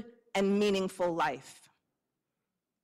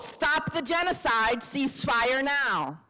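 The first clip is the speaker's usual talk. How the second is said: calling out; 4 semitones higher than usual. Shown as a demonstration. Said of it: "calling prompts to the crowd".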